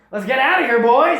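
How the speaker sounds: put-on rally the boys and bolt; mock rowdy soldier yell; gleeful horde shout. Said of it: "they're like".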